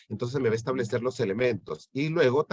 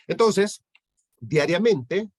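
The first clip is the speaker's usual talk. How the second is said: emphatic restart; 7 semitones higher, 6 dB louder, much slower than usual. Real, not acted.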